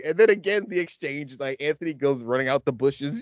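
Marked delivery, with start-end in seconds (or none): none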